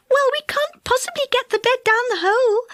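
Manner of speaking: high, plaintive fairy voice; despairing. A narrator's reading. Fairy stuck with a bed of sleepers, high and despairing. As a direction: lament it helplessly, pitch held high. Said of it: "said Silky in despair".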